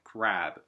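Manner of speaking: クラブ as "crab", R and muddy "æ". Shown as a demonstration. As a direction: say クラブ with an English R and æ. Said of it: "濁ったあってやつね". (「クラブ」を濁った「あ」で)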